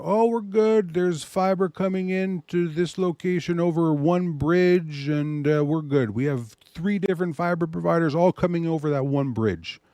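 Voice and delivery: mock the carefree client who thinks all is fine; complacent client. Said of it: "You know".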